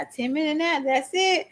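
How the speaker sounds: high, mock-disbelieving child; incredulous kid. Mom quoting her son bargaining over nap time. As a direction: act out the boy's outrage at a short nap. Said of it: "He said".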